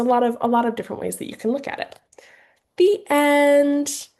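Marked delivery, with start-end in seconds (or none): 2.8-4.1 high and louder, announcing the end; bright, sing-out "The end"; cheery ending. Shown as a demonstration. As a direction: finish the book with a flourish. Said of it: "sign language".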